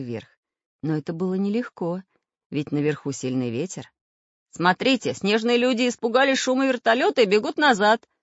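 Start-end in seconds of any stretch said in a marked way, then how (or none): 4.5-8.1 raised, louder, gleeful announcement; delighted. A narrator's reading. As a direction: announce the good news with joy. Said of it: "обрадовался Самоделкин".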